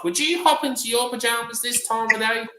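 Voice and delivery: high-pitched imitation of an old lady; elderly lady's question. Resident asking another lady about pajamas, imitated high elderly voice.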